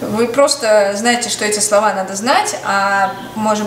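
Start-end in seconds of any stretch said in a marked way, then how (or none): none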